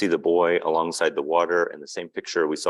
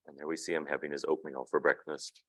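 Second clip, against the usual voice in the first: plain reading voice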